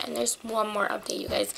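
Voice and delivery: ordinary delivery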